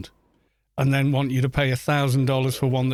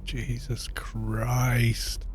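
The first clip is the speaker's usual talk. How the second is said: slow, drawn-out near-whisper; hushed disbelief; 3 semitones lower, 5 dB quieter, much slower than usual. Real, not acted. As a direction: breathe it out slowly in disbelief. Disbelief at absurd product prices.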